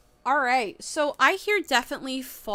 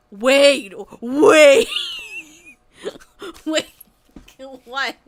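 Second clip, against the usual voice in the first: loud, higher laughing shout of "wait"; excited shout; 5 semitones higher, 12 dB louder, much slower than usual. Real, not acted. Excited shock at chat news.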